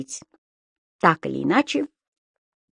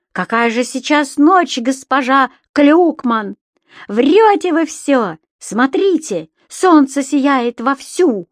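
louder, higher, drawn-out cheeky retort; shouting back; 6 semitones higher, 5 dB louder, slower than usual. A narrator's reading. Imp atop the wardrobe answers landlady as the tenant, shouting back. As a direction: call back defiantly, imitating a grown man. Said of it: "закричал ей в ответ со шкафа".

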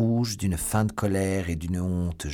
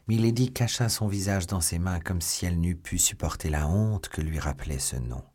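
plain reading voice

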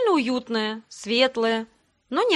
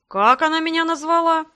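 high, loud incredulous question; raised voice, utterly taken aback; astonished; 6 semitones higher, 6 dB louder than usual. A narrator's reading. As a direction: ask in stunned disbelief. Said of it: "изумлённо произнёс бархатистый голос".

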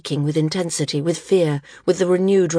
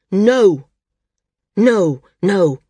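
louder, pained no; distressed refusal; 3 semitones higher, 7 dB louder, much slower than usual. A narrator's reading. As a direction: push the plea away loudly. Maris refuses her friend's plea.

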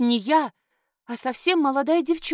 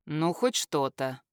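lower, slow smirking mutter; a wry, lower voiced smirk; smirking groom; 8 semitones lower, 5 dB quieter, slower than usual. A narrator's reading. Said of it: "усмехнулся мой будущий муженёк". (низкая неспешная усмешка)

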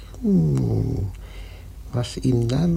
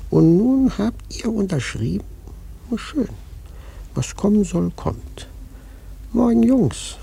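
mocking farewell; 6 semitones higher than usual. Prophet mockingly blessing the sailors as they leave. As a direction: bid them farewell with eerie glee.

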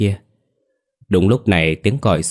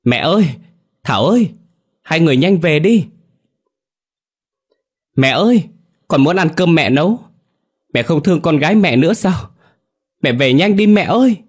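high, loud pleading cries; desperate loud calling; 9 semitones higher, 6 dB louder than usual. A narrator's reading. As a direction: call out with all your remaining strength, pleading for them to come home. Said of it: "dùng hết sức lực còn lại lớn tiếng gọi". (gọi lớn, giọng cao khẩn thiết)

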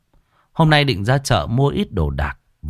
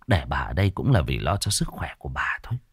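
hushed aside; 5 semitones lower, 5 dB quieter, faster than usual. A narrator's reading. Narration drops to a whisper.

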